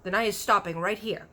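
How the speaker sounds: loud, offended giant; a curt, hurt giant; sharp giant. A narrator's reading. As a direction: giant cutting her off. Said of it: "the B F G said sharply".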